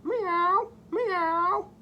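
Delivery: very high rising meow; crying cat. Acted. Voicing the cat for the children, high meowing cry. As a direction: mew like a little kitten. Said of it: "cried the cat".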